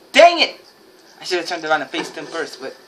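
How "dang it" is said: high, loud outburst; frustrated shout. Real, not acted. Gamer getting shot by someone, frustrated shout. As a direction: yell out in sudden frustration.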